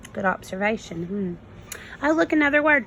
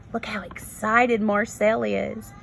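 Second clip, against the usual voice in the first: ordinary delivery, nothing marked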